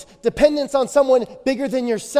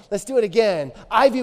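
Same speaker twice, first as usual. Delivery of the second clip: ordinary talk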